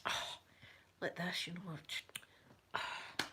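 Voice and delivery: soft exasperated huffs; a quiet put-out old man's huff; lightly imitated annoyed old man. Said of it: "he's like".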